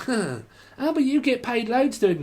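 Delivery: high-pitched mimicked objection; mocking objector.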